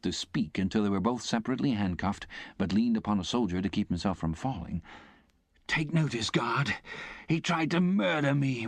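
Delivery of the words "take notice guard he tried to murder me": breathless, higher pleading accusation; frightened gasping convict. Acted. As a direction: beg the guard for help.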